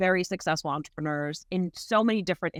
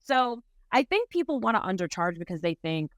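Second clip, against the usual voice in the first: ordinary talk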